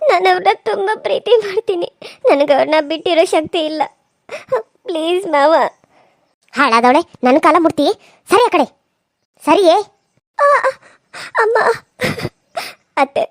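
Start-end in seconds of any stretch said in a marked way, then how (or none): 0.1-5.5 tearful plea, voice breaking into sobs; crying. Acted. Begging mother-in-law, weeping. 10.4-11.6 high sharp pained cries; cry of pain. Acted. Hair pulled by mother-in-law.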